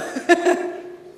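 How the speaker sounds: breathy laugh at her own joke; short airy laugh. Real, not acted. Toasting friend laughing at her own joke.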